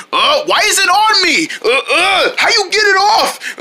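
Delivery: high, straining cartoon panic; hammed-up frantic fussing; frantic complaint. Struggles with hair on face.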